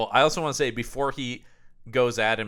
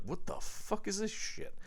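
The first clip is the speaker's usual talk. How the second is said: higher, hushed goofy voice of a baffled character; goofy quote; 8 semitones higher, 10 dB quieter than usual. Retold or imitated. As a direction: squeak it out like a confused goofball.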